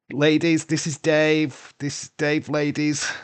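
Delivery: loud, high, drawn-out announcement; playful introduction. Pretend. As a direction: present Dave proudly to a room of women. Acts out introducing Dave to women.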